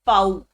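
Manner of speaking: पाउ emphatic, one syllable rhyming with "now", starting on f; German letter V. Shown as a demonstration. Teacher naming German V, पाउ said emphatically as "fow". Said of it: "वी को बोलते हैं".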